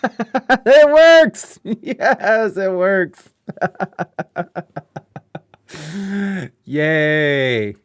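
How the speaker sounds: high, loud laughing cheer; delighted laughing shout; laughing relief. Real, not acted. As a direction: burst out laughing that it finally works. Alert finally plays.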